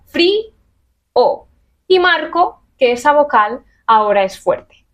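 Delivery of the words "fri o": fri-o slowly, with the I and the O pulled apart; split into syllables. Shown as a demonstration. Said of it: "Y entonces digo". (fri-o lento, separando la I de la O)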